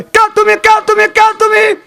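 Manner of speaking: high shouted who are you; barking challenge. Shown as a demonstration. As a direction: bark it out like a guard dog. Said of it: "এইভাবে বলে না".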